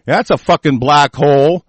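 ordinary talk

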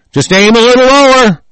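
raging threat; angry shout. Real, not acted. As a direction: threaten them at full voice. Threatening rant at enemies.

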